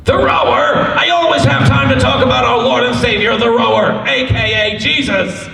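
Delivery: high, full-voiced evangelist outburst; mock zealous proclamation. Asked about our Lord and Savior, zealous proclamation. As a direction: proclaim it at a high pitch like a fervent believer. Preacher bit about the Rower.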